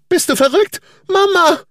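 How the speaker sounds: put-on the boy screaming in shock; panicked child. A narrator's reading. Son screaming at his father after the fall, high and loud.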